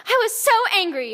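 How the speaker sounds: usual speaking voice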